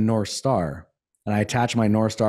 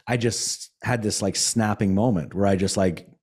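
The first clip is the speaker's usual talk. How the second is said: ordinary talk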